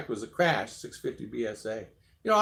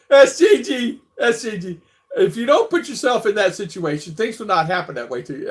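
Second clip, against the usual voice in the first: laughing; 7 semitones higher, 8 dB louder than usual. Retold or imitated. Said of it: "I'm laughing so hard".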